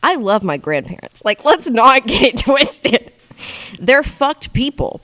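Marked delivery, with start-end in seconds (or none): 1.2-5.0 high, slow laughing through the words; giggling as she admits it. Real, not acted. To viewers about grandparents, laughing high and slow.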